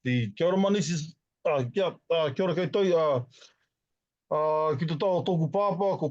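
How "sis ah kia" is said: ordinary talk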